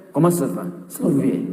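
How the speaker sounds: low-pitched imitated French accent. Shown as a demonstration. Preacher demonstrates learning French, lightly imitated French accent. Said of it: "Bahasa Prancis dia belajar".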